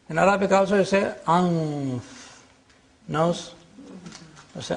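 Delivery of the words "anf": "anf" with a long hidden n before f; ikhfa. Shown as a demonstration. Teacher giving the Arabic for nose, long hidden n in "anf". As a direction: hum the n of "anf" in the f position. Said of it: "This is ikhfa".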